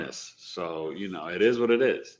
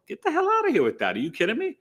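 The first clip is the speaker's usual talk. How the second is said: indignant; 11 semitones higher, faster than usual. Real, not acted. Indignant rant about bad rappers.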